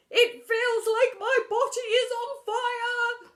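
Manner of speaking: high, loud, slow anguished cry; cried out. Acted. Teacher fleeing with burning bottom, crying out. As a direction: wail it in burning pain. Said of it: "cried Mister Phoebe".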